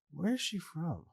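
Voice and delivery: mock puzzlement. Host imitates listeners puzzled by her accent, mock baffled. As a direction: playfully puzzle over someone's accent.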